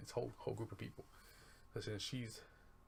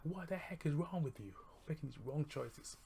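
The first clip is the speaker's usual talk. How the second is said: high-pitched telling-off, half-hearted; mock mad scold; 5 semitones higher than usual. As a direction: scold your friend in a squeaky mock huff. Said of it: "Katie's just got mad".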